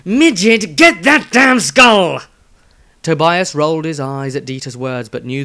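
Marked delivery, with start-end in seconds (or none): 0.0-2.2 high, loud, slow snarl at the halfling; snapping mercenary. Acted. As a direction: bark it out like a rough sellsword. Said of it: "Dieter snapped at the halfling".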